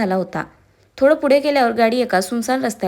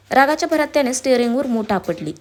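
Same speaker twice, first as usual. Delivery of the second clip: usual speaking voice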